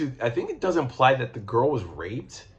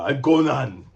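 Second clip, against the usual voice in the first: short, louder mock-Austrian Conan; Arnold Schwarzenegger; 3 semitones higher, 5 dB louder than usual. Shown as a demonstration. Reader showing the Conan voice he rejects, mock Arnold impression. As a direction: put a thick Austrian accent on it. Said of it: "I do not do Arnold Schwarzenegger".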